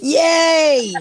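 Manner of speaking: loud happy yay; cheering. Real, not acted. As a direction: cheer for a favourite line. Cheering the catchphrase.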